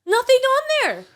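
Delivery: high, loud, fast outrage; indignant rant. Real, not acted. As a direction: rant in disbelief at the blank canvas. Ranting about blank painting.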